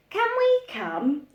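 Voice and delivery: put-on high, pleading little boy; little boy's voice. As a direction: pipe up like a small boy begging. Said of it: "asked Lester".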